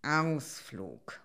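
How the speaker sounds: wolf's slightly grumpy mumble; grim murmur. A narrator's reading. Wolf answering the bear about the outing, grimly murmured. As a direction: mutter it with sour doubt. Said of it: "murmelte der Wolf etwas grimmig".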